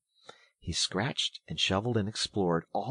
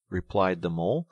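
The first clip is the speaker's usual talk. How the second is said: ordinary delivery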